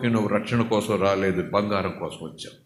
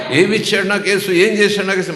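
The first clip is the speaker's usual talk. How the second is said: loud, much higher indignant outcry; complaining believer; 8 semitones higher, 8 dB louder, faster than usual. Retold or imitated. A believer complaining what Jesus did for them, loud and high. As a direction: hurl the complaint at heaven.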